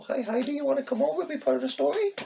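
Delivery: high sing-song call to a cat; high coaxing pet-talk. Real, not acted. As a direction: coax the kitty up in a high pet voice. Calling to the cat.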